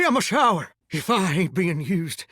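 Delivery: ordinary delivery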